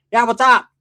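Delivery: loud, high, challenging greeting; imitating a confrontational woman; hostile phone answer.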